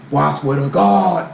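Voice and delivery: slow, drawn-out mock oath; demonstrative swearing. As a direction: stretch the oath out to oversell it. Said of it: "the demonstrative stuff, trying to sell it to me".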